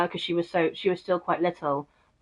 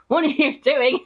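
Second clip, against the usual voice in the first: high, louder, laughing through it; laughing mock question; 7 semitones higher, 4 dB louder than usual. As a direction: ask it while cracking up, pitched up. Mocking the cousin's silly panic.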